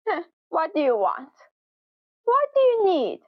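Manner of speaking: curt, fast and annoyed, rising high at the end; rude demo. Shown as a demonstration. Teacher showing a rude coworker, annoyed and curt. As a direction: snap it out like you're bothered by the interruption. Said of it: "This is a little bit rude".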